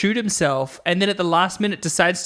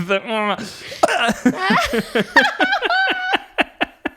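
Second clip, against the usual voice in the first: high laugh; 13 semitones higher than usual. Real, not acted. Laughing at own story.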